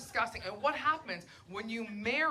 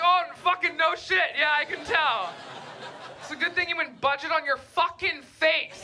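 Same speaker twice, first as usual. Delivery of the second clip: sarcastic shout; 5 semitones higher, 4 dB louder than usual. Retold or imitated. Her sarcastic retort, shouted.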